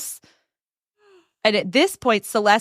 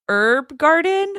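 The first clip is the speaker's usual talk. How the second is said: sheepish high question; 7 semitones higher, slower than usual. Retold or imitated. Celeste's guilty high question.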